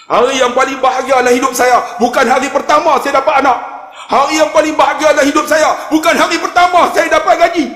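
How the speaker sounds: high, impassioned declaration; building emotional testimony. Retold or imitated. Climax of man's retold testimony.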